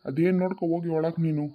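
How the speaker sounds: ordinary talk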